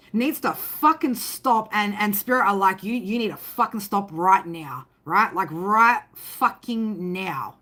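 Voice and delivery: slow, each word hammered home; stern emphatic warning. Real, not acted. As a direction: lay down the law to them.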